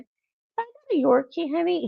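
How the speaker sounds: high, sweet-talking mock plea; coaxing wife. Sweet-talking husband in retold request.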